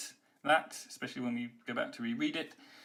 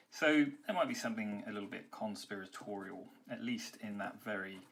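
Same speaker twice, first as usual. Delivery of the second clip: ordinary talk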